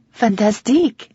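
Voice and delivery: put-on French housekeeper's heavily accented reply; heavy French accent. A narrator's reading. Housekeeper answers how she is, heavy French accent. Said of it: "she says with a heavy French accent".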